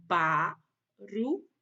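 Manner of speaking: teacher sounding out "barulho"; syllable by syllable. Shown as a demonstration. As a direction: separate each syllable of "barulho" with a pause. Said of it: "por sílabas e monte as palavras".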